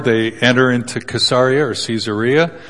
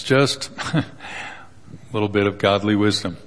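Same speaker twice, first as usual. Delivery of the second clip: ordinary talk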